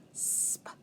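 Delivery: teacher isolating a blend; whispered blend. Shown as a demonstration. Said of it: "what do you hear in spider".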